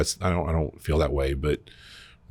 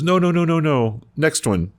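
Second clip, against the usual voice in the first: mock attendant; 12 semitones higher, 6 dB louder than usual. Attendant steering to other stall.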